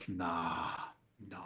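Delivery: a low, soft, flat-out no; low dismissive mumble. Retold or imitated. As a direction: brush the idea off under your breath. Friend dismisses business idea.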